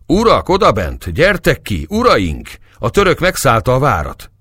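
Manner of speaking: high, loud urgent alarm; shouting. A narrator's reading. Young Hungarians outside the tent to their lords, shouting. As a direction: call the lords out with full urgency. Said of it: "valamennyien kiáltoztak".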